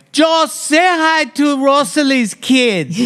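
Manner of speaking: high, slow aunt voice; slow high coaxing aunt; imitating aunt. Aunt prompting uncle to greet kids, high and slow. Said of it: "and Aunt Rose would say".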